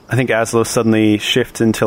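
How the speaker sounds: ordinary delivery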